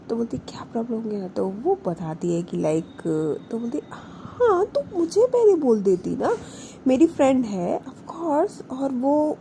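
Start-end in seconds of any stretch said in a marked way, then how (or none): 0.4-1.3 breathy hushed whisper; whispered question. Retold or imitated. Hushed question between friends. 3.9-9.4 emotional outburst. Retold or imitated. Friend upset, offering help emotionally.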